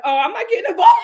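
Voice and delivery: high, loud laughing refusal; loud mock refusal. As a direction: shout it backing off. [name] backing out.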